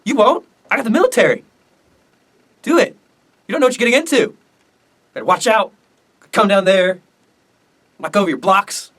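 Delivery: high-pitched mock dare; acted taunting threat. Jackson daring South Carolina, acted.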